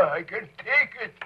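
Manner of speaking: very high mock-heroic climax; heroic plea. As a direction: cry out you can bear it.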